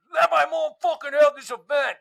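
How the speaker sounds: put-on high, loud outburst from a thirteen-year-old; hopped-up kid. Thirteen-year-old Bobby defending mom's event, hopped-up high yelling. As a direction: shout it high like a soda-wired kid. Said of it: "she's all hopped up on Doctor Pepper".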